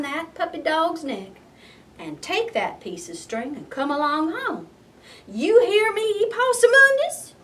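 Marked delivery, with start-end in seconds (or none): 5.3-7.3 much higher, loud call of a stern mother; mama calling out. Acted. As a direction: call him sharply. Mama calling her son.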